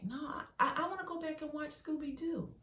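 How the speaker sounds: put-on high, stammering kid's voice; a frightened child wanting to go back to cartoons; scared small child. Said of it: "you was like".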